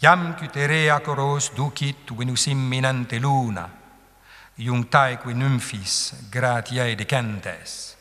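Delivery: "Iam cutere acoros" steadily beaten, "iunctaeque nymphis" slow with held longs; scanned Latin distich. Shown as a demonstration. Teacher reads one last distich, metrical Latin recitation. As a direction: recite in measured classical metre. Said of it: "Un dernier".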